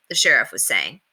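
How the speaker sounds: ordinary delivery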